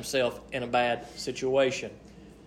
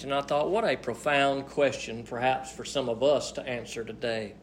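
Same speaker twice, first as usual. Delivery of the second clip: ordinary delivery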